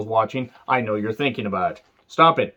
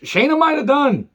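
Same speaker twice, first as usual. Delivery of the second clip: high squeak; 14 semitones higher, 3 dB louder than usual. Acted. Jokey squeak.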